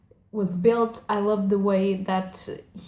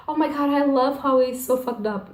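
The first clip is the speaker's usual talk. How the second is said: higher-pitched, hammed-up fangirl gush; mock gushing; 5 semitones higher than usual. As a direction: imitate an over-excited fan. Mock fan reaction she rejects.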